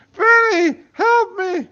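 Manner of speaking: high shrieking cry for help; screaming Bella. Acted. Bella screams for Vinny to help her. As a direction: scream it, terrified. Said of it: "I heard a scream".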